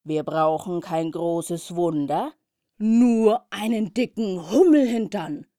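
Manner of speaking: loud, slow and resolute; determined. Acted. Hannah decides to save the others, loud and determined. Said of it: "war Hannah entschlossen".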